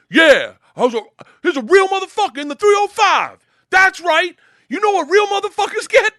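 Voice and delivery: loud, very high hollering; full-volume swaggering stage-crasher; bellowed boast. Retold or imitated. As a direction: holler it to work up the crowd. Ray boasts loudly, acted.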